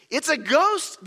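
high frightened cry; mock terrified cry; cried out in fear. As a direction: cry out that there's a ghost. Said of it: "they cried out".

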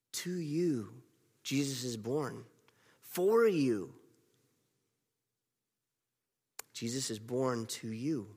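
soft, intimate closing words; tender. Real, not acted. Preacher gently telling the congregation Jesus is born to them. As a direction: hush it, make it personal.

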